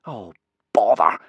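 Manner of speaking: exasperated boy's grumble, lightly acted; complaining. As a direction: punch out the complaint. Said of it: "complained Hiccup".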